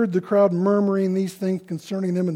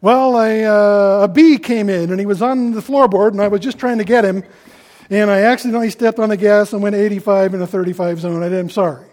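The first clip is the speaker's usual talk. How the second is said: loud, hurried, made-up excuse to an officer; hammed-up driver talking out of a ticket; flustered excuse-maker; 3 semitones higher, 9 dB louder than usual. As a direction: blurt out a flimsy alibi, louder than usual. Acting out a traffic ticket excuse.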